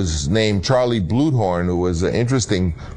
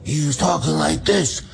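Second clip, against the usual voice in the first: higher, hoarse imitation of a throat-shot Don; a light hoarse take on Brando's Godfather voice; 5 semitones higher than usual. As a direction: rasp it out like a man shot in the throat. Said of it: "his voice should be very hoarse".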